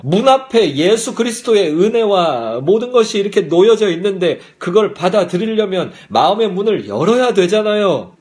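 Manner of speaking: normal talking voice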